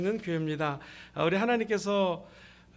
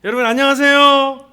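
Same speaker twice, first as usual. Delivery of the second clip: big, higher-pitched shouted hello; loud greeting; 4 semitones higher, 13 dB louder than usual. Real, not acted. Preacher greeting the student crowd, loud shout. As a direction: call out to the whole hall.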